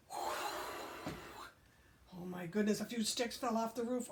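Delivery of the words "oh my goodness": ordinary delivery, nothing marked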